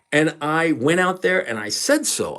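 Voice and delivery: normal talking voice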